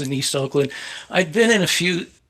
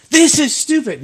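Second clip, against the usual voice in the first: high-pitched, exasperated imitation; high, indignant mock outburst; retold angry objection; 13 semitones higher, 3 dB louder than usual. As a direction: act out an angry boss dismissing an idea. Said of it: "he gets so mad".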